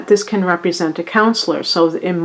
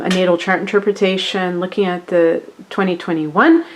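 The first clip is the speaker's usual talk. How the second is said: usual speaking voice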